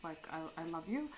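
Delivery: hushed, tentative plea; retold quiet prayer. Woman who didn't know how to pray, praying softly. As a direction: pray softly, halting between the phrases.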